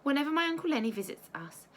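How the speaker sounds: plain reading voice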